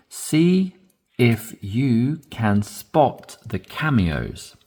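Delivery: if you can spot the cameos read slowly; slow model reading. Shown as a demonstration. Teacher modelling a sentence for repetition, slow. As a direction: take it word by word, slowly. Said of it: "Moving on to these three now".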